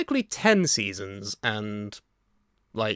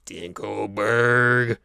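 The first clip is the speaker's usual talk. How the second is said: slow; 3 semitones lower, much slower than usual. Acted. Dramatic repeat of villain name.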